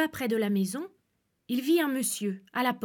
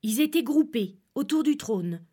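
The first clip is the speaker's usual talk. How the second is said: usual speaking voice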